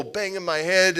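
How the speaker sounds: ordinary talk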